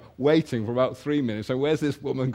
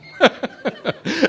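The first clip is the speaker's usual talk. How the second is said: laugh; 11 semitones higher, 7 dB louder than usual. Real, not acted. Laughing at her reaction.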